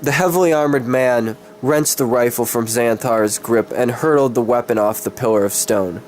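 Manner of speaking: plain reading voice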